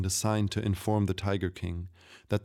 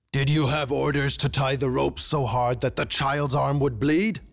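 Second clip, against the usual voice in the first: high-pitched, louder toward the last word; furious interrogation; 6 semitones higher, 4 dB louder than usual. A narrator's reading. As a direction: cut in and berate the guard. King interrupts, berating the guard.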